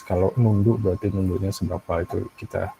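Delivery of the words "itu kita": ordinary talk, nothing marked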